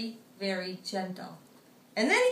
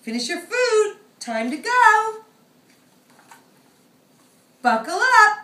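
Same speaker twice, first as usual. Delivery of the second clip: bossy mom; 11 semitones higher, 10 dB louder than usual. Acted. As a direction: hurry him along. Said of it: "Big Chicken says".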